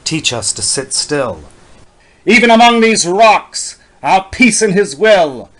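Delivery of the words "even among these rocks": ordinary delivery, nothing marked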